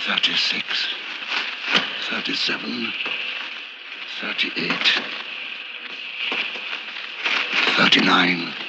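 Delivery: hushed count, step by step; counting under breath. Acted. Narrator counting steps in a dark cave, hushed. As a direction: murmur each number as you feel your way down. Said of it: "I counted them as I went".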